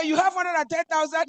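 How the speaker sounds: high, loud astonished question; shocked disbelief. Retold or imitated. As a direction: blurt it out in shock. Retold shocked reply.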